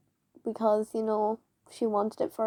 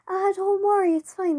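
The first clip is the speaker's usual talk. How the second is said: dismissive teacher; 9 semitones higher, 4 dB louder than usual. Retold or imitated. Teacher brushing off bullying.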